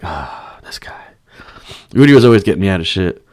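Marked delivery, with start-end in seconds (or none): 0.0-1.0 breathed, near-whispered aside; exasperated sigh. Retold or imitated. Usher shaking his head at rowdy fan, whispered sigh. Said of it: "he would just shake his head like".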